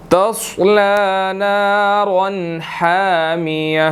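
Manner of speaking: slow, drawn-out tajweed recitation; slow Quranic recitation. Shown as a demonstration. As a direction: recite the full verse slowly. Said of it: "teacher recites the verse".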